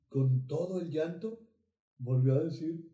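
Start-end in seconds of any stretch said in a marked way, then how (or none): none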